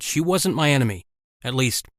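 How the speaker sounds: ordinary delivery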